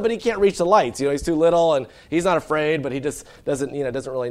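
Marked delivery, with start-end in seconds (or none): none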